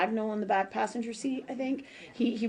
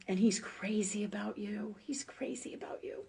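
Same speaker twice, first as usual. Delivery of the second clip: soft unvoiced whisper of a loving message; tender whispered reassurance; 9 dB quieter than usual. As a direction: breathe the words like a secret from beyond. Relaying grandfather's loving message.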